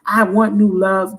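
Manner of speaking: normal talking voice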